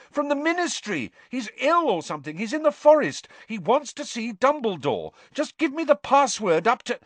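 high-pitched, frantic pleading; high, insistent outburst; urgent shouting. A narrator's reading. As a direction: shout the news urgently until cut off. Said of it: "Harry shouted".

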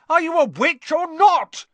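very high, loud, exasperated shout; bellowing. A narrator's reading. Boy reminding the witch of her magic, bellowing. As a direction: yell it at full pitch. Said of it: "Ron bellowed".

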